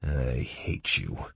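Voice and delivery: drained, quiet hatred; quiet croak. A narrator's reading. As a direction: croak it slowly, under the voice. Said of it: "I croaked quietly".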